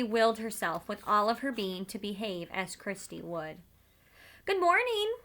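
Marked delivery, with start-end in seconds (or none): 4.5-5.2 put-on high, careful girlish greeting; a small child's cautious hello; carefully. A narrator's reading. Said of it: "she said carefully to the soldiers".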